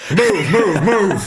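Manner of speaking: loud, high, urgent command; acted shout. Player barging through concerned families, urgent acted shout. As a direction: clear the crowd with repeated shouts.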